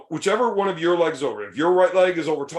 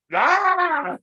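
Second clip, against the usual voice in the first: sharp high cry of pain; scream in pain. Retold or imitated. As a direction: yell out like your knee just tore. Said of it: "can you do the scream again?".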